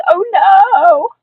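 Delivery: high, loud, drawn-out wail; child's frantic cry; panicked shout. A narrator's reading. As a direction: shout it in alarm. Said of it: "I shouted".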